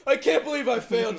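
high, loud, overwrought; hammed-up doctor meltdown; mock dramatic doctor. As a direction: storm off in a huff.

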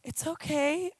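put-on calm a crying child down; reassuring mom. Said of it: "swallow really, really quick and go".

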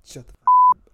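very loud, high-pitched outburst; mock yell. Host showing what is usually said, mock yell. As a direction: belt it out at your friend in play.

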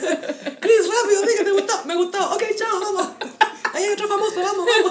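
high, loud, hurried producer; frantic producer. Retold or imitated. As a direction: rush the crew, urgent and loud. (productor apurado gritando)

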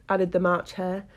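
ordinary delivery

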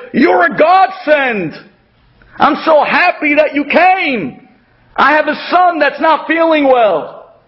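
shower him with thanks like he saved you; overjoyed thanks. Retold or imitated. Giver thanking the poor man.

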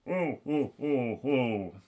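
put-on groaning prisoner voice; mumbling prisoner. Fellow prisoner across the room groaning, mumbled character voice. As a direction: moan as a tied-up captive would. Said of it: "familiar voice mumbled".

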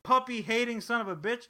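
outraged viewers. Retold or imitated. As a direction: blast him like an outraged fan. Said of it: "people are like".

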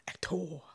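"actor" with a full, hard O in "-tor". Shown as a demonstration. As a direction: say actor as "ac-TOR", the O fully sounded. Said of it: "with the hard O".

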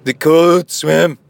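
loud, high mock Scottish brogue; Scottish accent. Host finishing listener's email, loud put-on Scottish accent. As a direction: drag out the last words in hammed-up Scots. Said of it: "Should I read it with my Scottish accent?".

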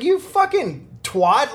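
high, drawn-out indignant insult; retold yelling. Man cursing the woman who won't clean up, slow high outraged retold yell. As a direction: stretch it out in high-pitched mock outrage. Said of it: "I just started fucking yelling at her".

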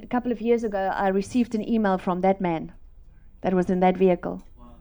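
ordinary talk

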